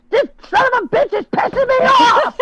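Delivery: high, loud retold rant; angry outburst. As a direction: yell it, laughing at yourself. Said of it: "I said".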